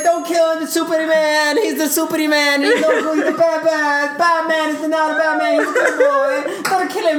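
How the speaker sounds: high, droning comic plea; Lois Lane. Retold or imitated. Friend mocking Lois begging for Superman, high plea. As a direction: plead in a silly high monotone. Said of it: "then Lois Lane's like".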